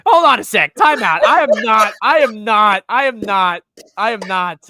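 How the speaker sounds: loud laughing protest. Real, not acted. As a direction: shout it down while laughing. Protesting a joke loudly.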